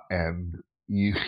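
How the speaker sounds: ordinary talk, nothing marked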